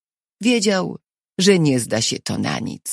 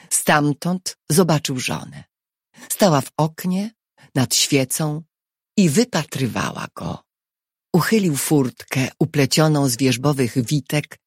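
plain reading voice

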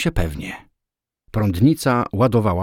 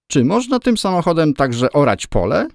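high, mock-innocent question; polite mockery; 8 semitones higher than usual. A narrator's reading. Moskvich owner politely asking about the car, openly mocking. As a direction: ask courteously with obvious scorn. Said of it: "z oczywistą kpiną w głosie".

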